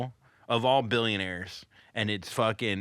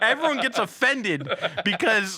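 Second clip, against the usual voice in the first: laughing; 10 semitones higher than usual. Real, not acted. Still laughing about it.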